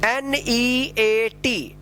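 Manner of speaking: each letter held long; drawn-out, separate letters; slow spelling. Shown as a demonstration. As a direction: spell it very slowly for learners. Teacher spells out word for learners.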